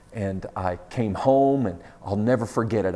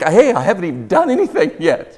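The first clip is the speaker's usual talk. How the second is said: high and loud, laughing as you say it; dad laughing in mock innocence; laughing protest; 10 semitones higher, 10 dB louder than usual. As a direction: playfully defend yourself to a little son. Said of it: "I'm like".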